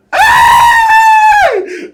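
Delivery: sudden high loud yell of excitement; hype shout. Real, not acted. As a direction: let out a big hyped shout. Shouting out mid-story, hyped.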